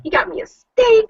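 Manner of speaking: high, gleeful anticipation; excited. Retold or imitated. Wife retelling her joy at husband's steak bag, high and gleeful. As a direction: gush like a kid promised a treat. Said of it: "And I'm all excited".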